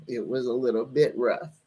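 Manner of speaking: teary laugh dropping into low words; tearful laugh. Real, not acted. Host admitting the reading was rough, laughing through tears. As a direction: laugh it off while still crying. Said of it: "I'm up here crying".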